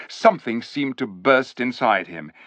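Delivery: ordinary delivery, nothing marked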